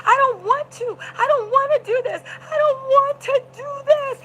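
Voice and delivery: high, tearful wail, imitated; whining high-pitched sob of protest; mock screaming. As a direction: act out a crying, desperate plea. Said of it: "Jane started screaming".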